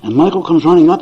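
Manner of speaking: usual speaking voice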